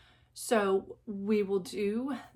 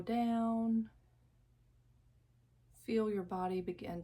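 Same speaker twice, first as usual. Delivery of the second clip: guided meditation; 6 dB quieter than usual. Acted. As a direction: lull the listener into calm, drawing out each phrase. Leads a guided visualization.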